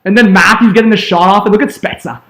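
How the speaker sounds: ordinary talk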